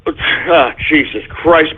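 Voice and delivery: ordinary talk, nothing marked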